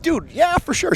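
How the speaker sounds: imitating an easygoing high-pitched yes; high, obliging retold reply; eager agreement. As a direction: agree readily, voice lifted high. Acting out Thunderbird's eager yes.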